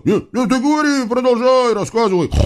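high, hasty cover-up; caught-out devil urging her on; flustered devils. A narrator's reading.

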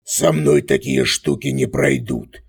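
deep, slow jeering villain; jeer, slow and low. A narrator's reading. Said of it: "глумливо сообщил Козлоногий".

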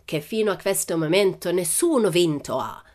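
put-on foreign-accented ringmaster introducing the champion; showman's announcement in broken Italian; circus director. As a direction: present him grandly, stretching the final name.

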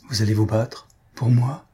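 ordinary delivery, nothing marked

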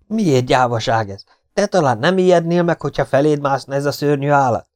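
high, loud, aggrieved voice; frightened partisan, indignant. A narrator's reading. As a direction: defend yourself in a scared high pitch.

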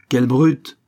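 passerby condemns the brutal father; clear, melodious indignation. A narrator's reading. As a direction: judge him out loud, clear voiced. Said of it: "une voix mélodieuse et claire".

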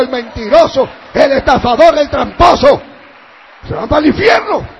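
loud, driving sermon voice; full-force preaching. Acted. Pastor thundering sins at the congregation, full-force preaching. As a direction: hammer each sin out to the congregation.

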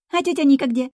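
put-on high child's voice, curious; eager little-girl question. A narrator's reading. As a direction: ask like a small child, pitched high. Small daughter asks her mother.

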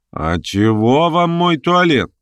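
drawn-out retort. A narrator's reading. Verkhovensky retorts to the jibe.

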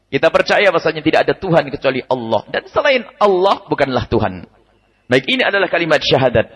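normal talking voice

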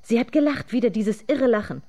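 high, loud exclamation; agitated outcry. A narrator's reading. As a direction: cry it out in distress. Agitated repeat about mad laughter.

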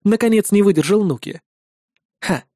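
plain reading voice

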